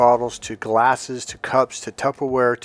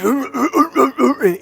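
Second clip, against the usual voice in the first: mock grunting, much higher, slow; lightly ape a grunting businessman; a hint of a caveman businessman; caveman-like rich guy; 12 semitones higher, 4 dB louder than usual. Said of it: "he's talking like this".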